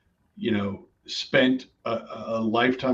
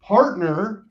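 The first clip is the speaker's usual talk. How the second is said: partner said higher and louder, set apart by a pause; stress on partner; 7 semitones higher, 4 dB louder than usual. Shown as a demonstration. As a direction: lean hard on the word partner. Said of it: "I wanna emphasize that word".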